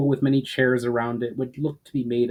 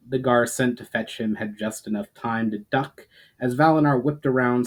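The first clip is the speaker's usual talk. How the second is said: usual speaking voice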